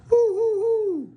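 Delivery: very high, loud hooting; owl hoot. Acted.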